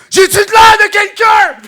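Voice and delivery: very high, loud, slow mock yell. Imitating the woman yelling at the police, shrill-pitched slow mock yell. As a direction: screech it like an outraged woman. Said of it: "elle gueulait tellement fort".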